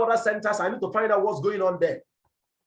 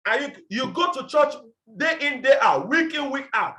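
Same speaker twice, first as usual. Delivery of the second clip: challenging shout; 5 semitones higher than usual. Real, not acted. Coach challenging churchgoers, raised and high. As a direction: push them hard with each phrase.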